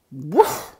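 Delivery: low, barked woof; a farm dog barking as it joins in; dog's bark. Acted. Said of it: "barked the farmer's dog".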